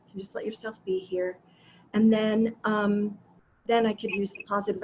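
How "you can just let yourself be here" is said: soft and higher with a lifting end on "here"; gentle self-reassurance. Retold or imitated. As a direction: coax yourself kindly, rising on "here". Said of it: "And then I was just like".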